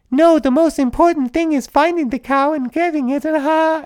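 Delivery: mock lady's voice. Podcaster mocks the dubbed Japanese lady's dinner line, high falsetto. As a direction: squeak it out like the dubbed lady. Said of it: "she goes like".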